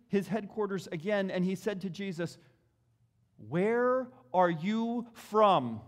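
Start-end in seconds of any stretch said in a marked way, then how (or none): 3.4-5.8 drawn-out, louder question; slow pressing question. A narrator's reading. Preacher voicing Pilate demanding where Jesus is from, slow and louder. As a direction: press him slowly for an answer.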